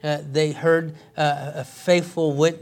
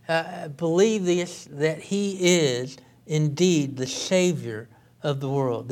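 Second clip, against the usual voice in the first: usual speaking voice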